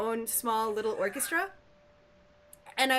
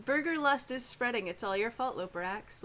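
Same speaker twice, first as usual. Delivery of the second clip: usual speaking voice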